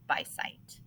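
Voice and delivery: a soft whisper on the last words. Shown as a demonstration. Teacher whispering to the class for emphasis, hushed.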